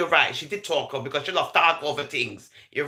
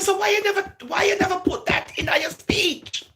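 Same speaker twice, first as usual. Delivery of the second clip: high crying shout; 13 semitones higher than usual. Retold or imitated. Quoting the woman on the call, a high crying shout. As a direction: push it high and pleading, close to tears. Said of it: "She said".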